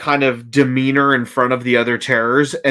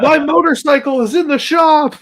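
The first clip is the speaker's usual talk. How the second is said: Nick parody; 13 semitones higher than usual. Retold or imitated. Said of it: "be like".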